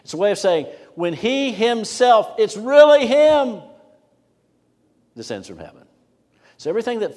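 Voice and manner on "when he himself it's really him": "he himself" and "really him" very high, loud, drawn out; emphatic HE HIMSELF. Shown as a demonstration. Preacher showing the emphasis on the subject, forceful and high. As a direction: punch "he himself", building louder and higher to "him". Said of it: "putting the emphasis on the he".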